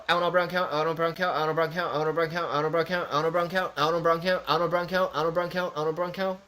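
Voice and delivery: run-together repetitions in a steady rhythm; rapid-fire tongue twister. Shown as a demonstration. Streamer testing a tongue twister, rapid-fire. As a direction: rattle it off over and over without pausing.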